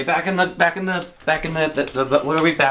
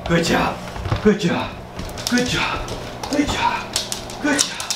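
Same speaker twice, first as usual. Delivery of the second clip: high excited praise; 9 semitones higher, 3 dB quieter, slower than usual. Real, not acted. Praising dog on floor.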